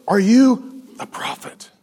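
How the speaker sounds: slow, whispered question; mock whisper. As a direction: whisper it slowly, acting out the questioner. Committee's next question, acted out.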